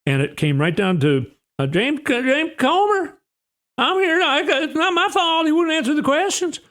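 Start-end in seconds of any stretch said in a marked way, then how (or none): 1.7-6.6 mock whiny Comer. Mocking Comer's excuse to committee.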